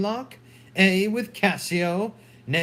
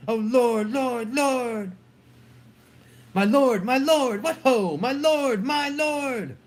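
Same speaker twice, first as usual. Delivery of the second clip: higher, urgent calls through a door; raised, pleading call from off; 6 semitones higher than usual. Acted. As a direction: call out for the lord from outside. Emilia calling from outside door.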